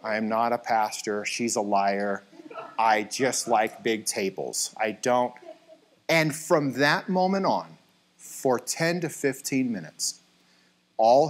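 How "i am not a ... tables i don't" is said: low, measured mock denial; deadpan denial. Husband denies being a pastor to furniture seller, deadpan. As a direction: play it straight while flatly disowning the claim.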